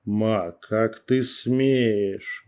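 loud, slow, thundering demon roar; booming demon voice. Acted. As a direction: rage at the summoner, booming. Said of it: "Грохочущий голос демона".

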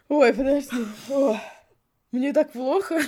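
high, loud, breaking up laughing; can't stop laughing. Real, not acted. Breaking up laughing while reading.

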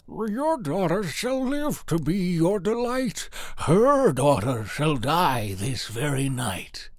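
high, loud yapping rhyme; barking little dog. A narrator's reading. Little dog under the table announcing the prophecy, barking. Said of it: "the little dog under the table began to bark".

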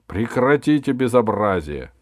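громкий королевский приказ; перекричи гул стадиона; гневный крик. A narrator's reading. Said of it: "закричал он, перекрывая весёлый гул". (властный окрик)

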